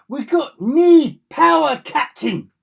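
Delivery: loud, high, drawn-out hammed-up Scottish cry; over-the-top Scottish starship engineer in a panic; Scottish accent. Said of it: "do my best Scottish accent".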